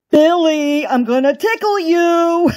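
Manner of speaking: high, teasing bunny threat; jolly voice. A narrator's reading. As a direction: playfully announce a tickle attack. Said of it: "laughed Uncle Wiggily in his jolly voice".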